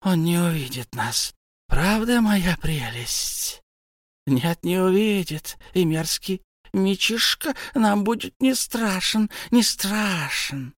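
high, slow, creepy creature; a wheedling creature talking to its treasure; Gollum voice. A narrator's reading. Gollum coos to his precious, high and slow.

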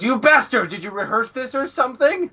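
high, loud indignant yell; acted outrage. Hichikata yelling at the teasing pair, outraged. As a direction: explode in hammed-up fury.

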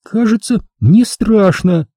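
high, slow frightened voice; a frightened woman admits it; scared girl. Acted. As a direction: let the fear creep in. Angela frightened.

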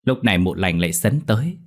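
usual speaking voice

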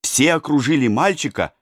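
ordinary delivery

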